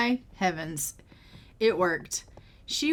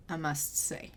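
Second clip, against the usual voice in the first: whispered aside; 5 semitones lower, 9 dB quieter than usual. Real, not acted. Aside about messy house.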